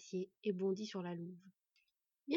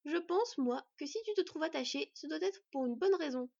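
put-on higher-pitched teenage defiance; brusque; 6 semitones higher than usual. A narrator's reading. Teenage boy accuses the chained wolf, brusque. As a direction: argue bluntly in a raised voice. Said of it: "lança brutalement l'adolescent".

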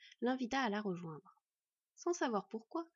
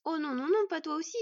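loud, high desperate outcry; anguished cry; 7 semitones higher, 8 dB louder than usual. A narrator's reading. Boy seeing his mother's wound, anguished cry. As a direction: cry out in horrified denial. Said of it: "s'écria-t-il".

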